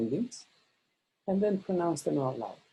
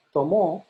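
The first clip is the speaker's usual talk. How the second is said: "tomo" stressed on the final mo; stress on last syllable; 4 dB louder than usual. Shown as a demonstration. Teacher shows students the wrong stress, final MO stressed in "tomo". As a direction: put the weight on MO in "tomo". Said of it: "So it's not".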